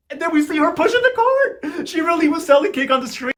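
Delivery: high-pitched, astonished squeal; incredulous high-voiced recap; gleeful disbelief. Real, not acted. Narrator gleefully astonished at the cart.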